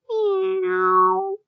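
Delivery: high little mewing kitten; squeaky kitten cry; kitten meow. Acted. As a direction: mew like a tiny kitten.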